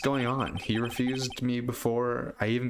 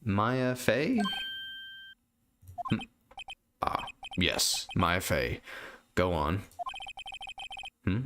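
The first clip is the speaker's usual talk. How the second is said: put-on pompous old lawyer. Old lawyer greeting the young visitor, pompous and slow. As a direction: savour every word like a grand old man. Grossberg voiced in game.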